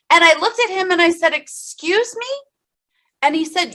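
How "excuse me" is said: much higher, rising, softer; incredulous. Retold or imitated. Patient repeating question to doctor, incredulous rise. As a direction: ask it in quiet disbelief.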